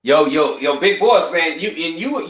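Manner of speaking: ordinary delivery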